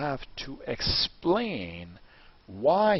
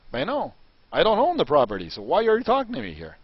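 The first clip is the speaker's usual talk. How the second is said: louder, higher hammed-up stubborn vendor; joking mock refusal; 5 semitones higher, 7 dB louder than usual. Mock vendor dodging the deal, hammed-up and loud. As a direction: play the absurd vendor for laughs. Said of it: "I'm joking at this point".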